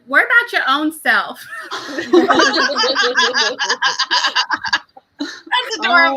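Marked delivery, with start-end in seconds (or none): 0.1-1.4 put-on loud, sassy brush-off from a small child; sassy little girl. Little girl telling her dad off, loud and sassy. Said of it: "She's like". 1.5-5.1 loud burst of laughter; laughing. Real, not acted. Friends laughing at the TikTok girl, loud laughter.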